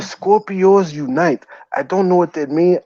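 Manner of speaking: ordinary talk, nothing marked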